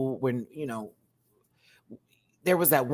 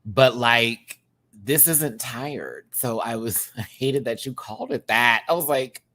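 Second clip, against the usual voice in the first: ordinary talk, nothing marked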